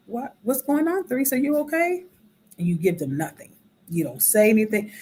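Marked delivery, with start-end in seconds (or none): 0.1-2.0 concerned friend. Retold or imitated. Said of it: "they may even ask".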